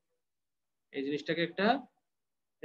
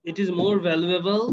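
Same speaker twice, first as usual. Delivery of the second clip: slow dictation; much slower than usual. Shown as a demonstration. Teacher dictating sentence to class.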